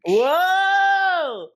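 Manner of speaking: loud high woah; big startled yelp; surprised shout. Real, not acted. Reacting to pun.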